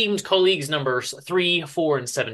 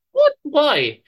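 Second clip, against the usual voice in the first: alarmed surprise; 11 semitones higher, 5 dB louder than usual. Acted. Wife shocked he is home early.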